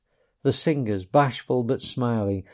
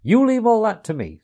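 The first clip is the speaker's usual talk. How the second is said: higher, louder confident command; masterful Rat; 10 semitones higher, 5 dB louder than usual. A narrator's reading. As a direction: take charge calmly. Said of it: "said the masterful Rat".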